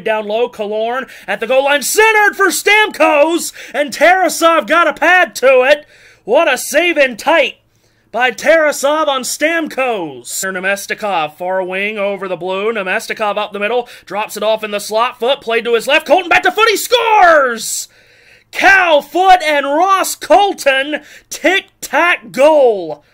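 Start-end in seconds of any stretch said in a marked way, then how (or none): 1.8-10.2 high, loud call of a save in tight; excited shout. Real, not acted. 16.0-23.0 high, loud goal yell, slowed at the end; goal call. Real, not acted.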